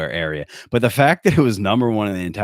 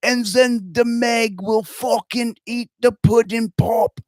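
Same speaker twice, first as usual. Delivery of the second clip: high, slow Cosby voice with an Aussie twist; mock Cosby hero on pudding pops; Australian Bill Cosby; 14 semitones higher, slower than usual. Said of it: "So it's an Australian Bill Co".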